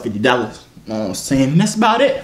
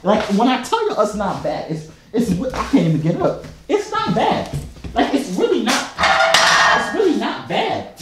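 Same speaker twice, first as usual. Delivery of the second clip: high-pitched, laughing disbelief; incredulous laughing protest; 7 semitones higher than usual. Real, not acted. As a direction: protest through helpless laughter, pitch climbing. Laughing protest that accent is fine.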